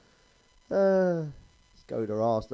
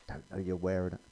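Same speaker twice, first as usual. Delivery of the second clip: lower, hushed "wearing it" like a gentle ad; soft advert voice; 7 semitones lower, 7 dB quieter than usual. Shown as a demonstration. Comic repeating the advert slogan, softly. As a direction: murmur it like the sanitary towel advert.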